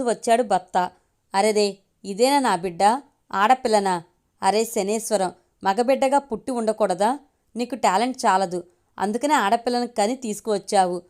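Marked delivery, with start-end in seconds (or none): none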